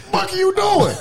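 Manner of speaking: dad yelling. Retold or imitated. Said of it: "I look at the stand, he".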